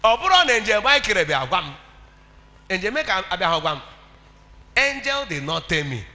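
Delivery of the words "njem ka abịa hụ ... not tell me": usual speaking voice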